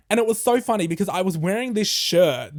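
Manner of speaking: usual speaking voice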